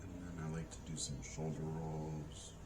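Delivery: soft, slow guided breathing voice; soft spa whisper. Acted. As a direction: guide them gently through each breath. Leads a breathing exercise.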